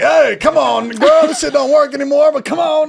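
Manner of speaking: Biden impression. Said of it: "DMs from Biden being like".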